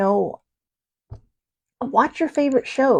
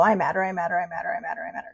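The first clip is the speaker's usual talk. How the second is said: mock robotic chant, quieter and fading; flat machine-like repetition, trailing off quieter; 4 semitones lower, 8 dB quieter, much faster than usual. Shown as a demonstration. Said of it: "don't be a robot and go".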